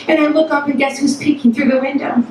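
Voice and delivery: ordinary delivery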